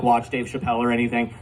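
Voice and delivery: ordinary talk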